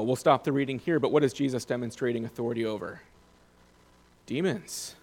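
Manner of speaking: ordinary talk, nothing marked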